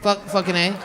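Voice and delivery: ordinary delivery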